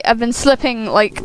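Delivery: ordinary talk, nothing marked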